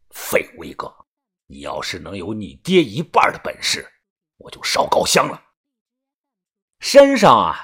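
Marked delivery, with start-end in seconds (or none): 0.1-5.4 low, hushed contemptuous grumble; scolding old man. A narrator's reading. Old man scolds pale Yuanbao, low contemptuous grumble. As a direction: sneer at the useless boy under your breath. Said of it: "李爷皱眉骂道".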